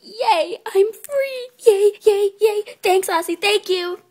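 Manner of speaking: high, loud, giddy cheering; bubbly squeals of delight; overjoyed. Acted. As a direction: celebrate being let loose. Colt is let out.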